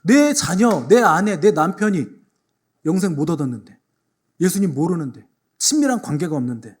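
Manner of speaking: ordinary delivery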